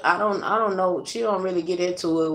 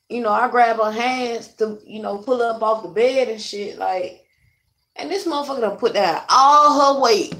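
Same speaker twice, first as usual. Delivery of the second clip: usual speaking voice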